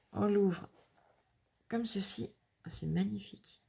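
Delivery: ordinary delivery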